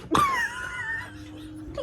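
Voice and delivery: very high squealing cackle; mocking laugh. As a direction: let out a long, shrieky laugh. Scornful outburst after taunting gunman.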